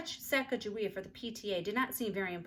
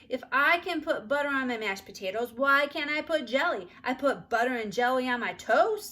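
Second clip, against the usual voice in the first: put-on 1 arguing child; 5 semitones higher, 8 dB louder than usual. A narrator's reading. Child argues at dinner.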